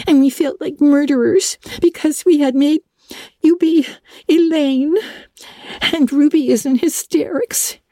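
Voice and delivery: put-on tearful, breathless young girl; excited girl. A narrator's reading. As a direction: gush with relief, high and fast. Diana voiced high, tearful.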